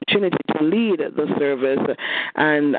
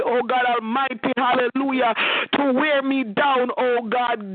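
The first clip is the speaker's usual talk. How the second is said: rising, impassioned prayer voice building toward a climax; fervent prayer; 7 semitones higher than usual. Real, not acted. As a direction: church prayer leader pouring out thanks.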